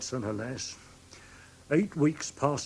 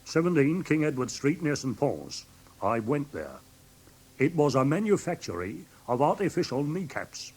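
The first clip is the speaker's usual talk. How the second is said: ordinary talk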